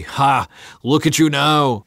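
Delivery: loud, jeering shout; mocking yell. A narrator's reading. Crowd to Jesus on the cross, jeering shout. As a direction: jeer up at him, loud and high. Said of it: "they yelled at him".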